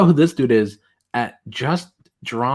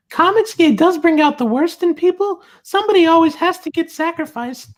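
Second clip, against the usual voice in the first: very high, loud falsetto parody; mocking tweet voice; 4 dB louder than usual. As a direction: read it out like a squeaky, solemn critic. Reads critic's tweet in mocking voice.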